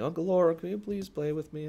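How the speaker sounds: put-on high-pitched little kid plea; young child voice. Young child asking uncle to play, high pleading voice. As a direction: imitate a small child begging to play. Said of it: "an adorable young someone".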